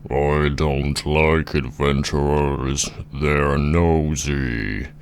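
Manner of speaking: very slow, drawn-out giant voice; ponderous, mighty giant; grumpy giant. Acted. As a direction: drag the words out like a disapproving giant. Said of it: "And then the giant says".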